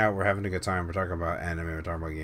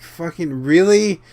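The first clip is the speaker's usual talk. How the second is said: exasperated disbelief; 10 semitones higher, 9 dB louder, slower than usual. Retold or imitated. Trains not running either.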